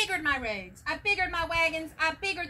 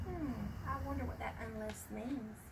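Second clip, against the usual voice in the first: much softer, quick murmured aside; quiet aside; 3 semitones lower, 12 dB quieter than usual. Real, not acted. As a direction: wonder it under your breath. Aside wondering to listener.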